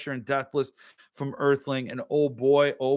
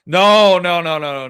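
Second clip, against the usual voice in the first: emphatic refusal; 3 semitones higher, 10 dB louder than usual. Real, not acted. Streamer refusing the accent, emphatic refusal. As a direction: shout down the request, then settle.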